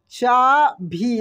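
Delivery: चाभी stretched with a long ई; slow teacher's demo of चाभी. Shown as a demonstration. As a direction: drag चाभी out slowly. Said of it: "तो ऐसे लिखेगा".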